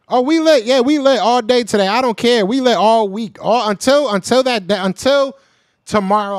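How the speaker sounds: real post-win hype; hyped celebration. Real, not acted. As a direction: crow about the victory all day. Celebrating his team's win.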